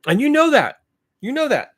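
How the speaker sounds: high, loud insistence; angry accusation. Real, not acted. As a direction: drive the point home at him.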